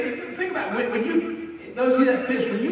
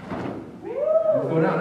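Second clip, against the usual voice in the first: laughing. Real, not acted. Laughing at a fishing clip.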